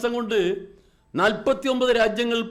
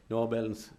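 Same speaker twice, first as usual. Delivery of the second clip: much lower and softer, a flat ATM answer; deadpan machine reply; 9 semitones lower, 8 dB quieter than usual. Retold or imitated. The ATM answers the waiting mother, low and deadpan. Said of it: "എന്താ പറഞ്ഞേ?".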